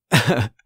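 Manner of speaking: quick low chuckle; a nervous little laugh; short mock chuckle. Ross laughs before speaking.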